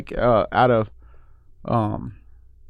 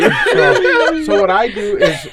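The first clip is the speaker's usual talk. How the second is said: laughing; 8 semitones higher, 4 dB louder, much slower than usual. Real, not acted. Laughing during demo.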